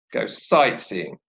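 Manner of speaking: "sightseeing" slow, SIGHT stressed, tune falling; slow model phrase with SIGHT stressed in "sightseeing". Shown as a demonstration. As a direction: lean on "sight", drop low through "seeing". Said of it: "the word sight takes the stress".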